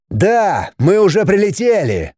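shouted answer; shouting over wind. A narrator's reading.

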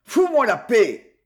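loud, irritated outburst from sleep; grumbling. A narrator's reading. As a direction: growl at whoever is waking you. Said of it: "Jean grogna".

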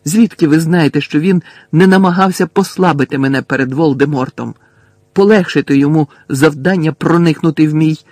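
loud, pressing question; agitated, loud interrogation; heated challenge. A narrator's reading. Harry challenges Dumbledore in the quarrel.

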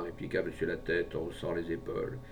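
ordinary delivery, nothing marked

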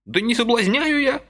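put-on high, plaintive old man's exclamation; a frail old man protesting pitifully; old man pleading he is not tempting Vasily, high and plaintive. A narrator's reading. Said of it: "жалобно воскликнул дедок".

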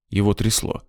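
usual speaking voice

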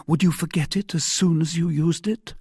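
high-pitched question rising at the end; earnest heroine. A narrator's reading. Wife asks the young man to forget the password, earnest. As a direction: lift it into a woman's searching question.

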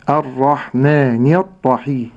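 الرحمن الرحيم slowly with light hamzat al-wasl; slow tajweed recitation. Shown as a demonstration. Sheikh modelling the verse, slowly. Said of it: "sheikh recites model".

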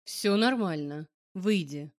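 slow, low calm male voice; calm order, slow and low. A narrator's reading. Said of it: "спокойно говорит он".

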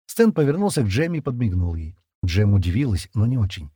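ordinary delivery

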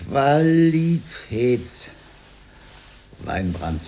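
slow, spellbound reading aloud; drawn-out reading. Acted. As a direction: stretch the word out very slowly. Reading a bottle label aloud.

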